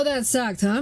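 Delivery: ordinary delivery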